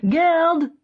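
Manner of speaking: loud, high joyful call of a name; glad cry. A narrator's reading. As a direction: shout the friend's name with delight. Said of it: "he cries".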